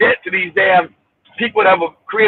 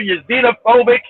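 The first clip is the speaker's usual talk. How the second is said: ordinary talk, nothing marked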